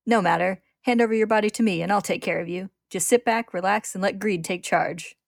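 loud, swaggering demand; cocky villain. A narrator's reading. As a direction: take command with brash confidence. Greed demands the body, cocky.

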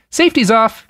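breezy cheer over grim business; cheerily. A narrator's reading. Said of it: "he said cheerily".

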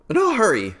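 a light carpenter voice. Acted. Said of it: "said the carpenter".

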